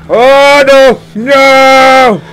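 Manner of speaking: high, loud drawn-out wail; panicked cry. Real, not acted. Gamer sees danger in the game, panicked cry. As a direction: cry out in alarm.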